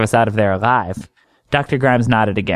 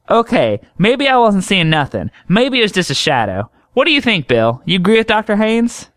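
high, chatty country doctor voice; high drawling woman doctor teasing a patient; folksy woman doctor; 10 semitones higher, 3 dB louder than usual. Acted. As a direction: tease him lightly in a higher homespun voice.

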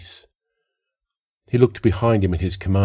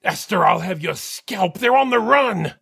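high, heated battlefield shout; 12 semitones higher than usual. A narrator's reading. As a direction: shout back, fired up. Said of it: "He shouted".

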